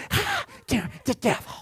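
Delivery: high, slow mock holy roller gasp; imitating grandma. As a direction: gasp it like a shocked old church lady. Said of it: "she would go".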